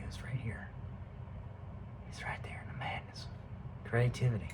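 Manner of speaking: very soft, whispered; barely voiced whisper; hushed aside. Real, not acted. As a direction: whisper it like a secret. Eerie low aside to viewer.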